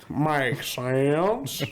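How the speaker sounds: exaggerated, stretched Texan drawl; hammed-up Southern Texan twang; mock Texan accent. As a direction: draw the vowels out like a Texan. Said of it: "like, a Texan accent".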